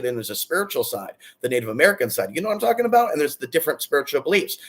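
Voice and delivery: ordinary delivery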